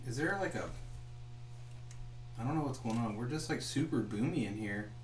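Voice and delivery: normal talking voice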